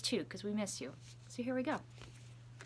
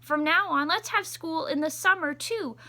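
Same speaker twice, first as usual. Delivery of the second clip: excited principal; 7 semitones higher, 6 dB louder than usual. Acted. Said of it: "called everyone together and said".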